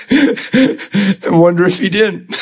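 slow words through real laughter; chuckling, high and slow; laughing. Real, not acted. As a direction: laugh it out, amused at the danger. Laughing at the devil's attack.

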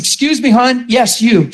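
retold call to a departing woman; calling after someone. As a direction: stop her at the door, pitch lifted. Calling after woman leaving service.

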